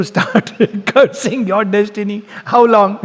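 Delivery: words broken by a real laugh; laughing through it. Real, not acted. Teacher amused at students cursing destiny, laughing. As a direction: chuckle as you say it.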